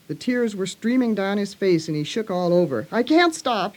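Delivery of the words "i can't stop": higher, mildly sobbing narrator's voice; a touch of tearful helplessness; gasping and sobbing. A narrator's reading. Colin choked by his tantrum, lightly acted sobbing. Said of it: "he gasped and sobbed".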